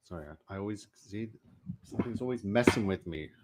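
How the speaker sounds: strained, choked-up throat. Real, not acted. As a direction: talk through a choked throat. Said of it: "this choked up voice".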